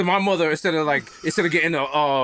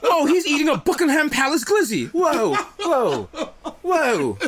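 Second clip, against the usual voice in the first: mock British commentator; 8 semitones higher, slower than usual.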